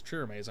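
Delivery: "triremes" as TRIH-ruh-maze; three-syllable "triremes" with a "maze" ending; alternative pronunciation. Shown as a demonstration. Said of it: "I don't know how those are pronounced".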